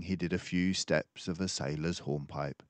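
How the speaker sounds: ordinary delivery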